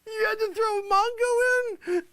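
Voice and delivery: high, tearful squeal of laughter; real hysterics, voice way up high; cracking up. Real, not acted. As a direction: wail it through helpless giggles. Cracks up on air.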